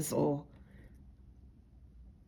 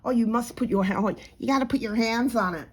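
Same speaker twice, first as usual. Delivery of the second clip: higher-pitched put-on American accent; mock American accent; 4 semitones higher, faster than usual. Mocking a reselling advice-giver, in an American accent. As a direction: poke fun with an exaggerated American accent. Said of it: "poking fun at the American accent".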